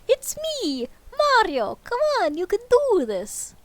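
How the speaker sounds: high Italian cartoon plumber; a bouncy Italian cheer; Mario voice. Ghost turned Mario cheers itself on in Italian accent.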